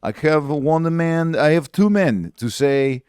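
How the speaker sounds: put-on slow, high Kazakh accent; Borat accent. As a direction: do Borat, slowly. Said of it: "He's like ... Borat".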